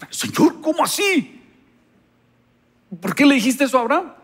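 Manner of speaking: whispered start bursting into a high shout of disbelief; shocked protest. Retold or imitated. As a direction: cry out in disbelief at God. Preacher shocked reading the Bible.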